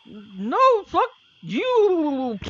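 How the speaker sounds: high, loud croaking scream; the toad's final breath, a shrieked insult; dying bullywug curse. Acted. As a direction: spit out a last curse as a dying toad. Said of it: "looks at you and goes".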